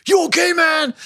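high shouted call of a builder; burly builder shouting over. Retold or imitated. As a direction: yell it across the street like a site worker. Builders calling out concern.

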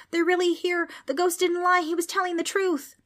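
put-on very high, frantic boy's voice; panicked scream. A narrator's reading. Preston realises the ghost didn't lie, screaming. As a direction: cry out in shocked realization. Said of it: "Preston screamed".

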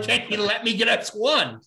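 high, put-on begging voice; mock pleading. Begging bank clerk in retold story.

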